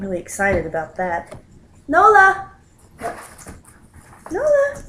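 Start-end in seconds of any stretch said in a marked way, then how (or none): 1.9-4.8 loud high call across the room. Real, not acted. Owner calling her cat over, loud high call.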